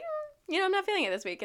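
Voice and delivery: high-pitched hedging hum and excuse; mock reluctance. Vlogger backing out of yoga this week, mock reluctance.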